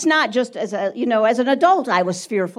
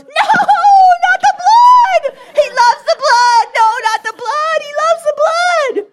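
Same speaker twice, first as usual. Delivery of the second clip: hammed-up terrified screaming; 10 dB louder than usual. Boy hearing Mom say plead the blood, terrified shriek. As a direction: scream in full mock panic, stretching the words. Boy acted in terror of vampire.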